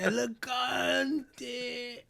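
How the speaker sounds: high, very slow, drawn-out swooning; mock awestruck exclamation. Crazy elegant guy seeing the change, high drawn-out rapture. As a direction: stretch out every vowel in hammed-up rapture.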